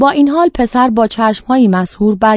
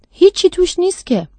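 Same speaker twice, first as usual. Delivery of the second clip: put-on a higher-pitched child's voice, mildly puzzled; lightly voiced boy; 10 semitones higher than usual. A narrator's reading. Boy points out the empty jar, lightly childlike and puzzled.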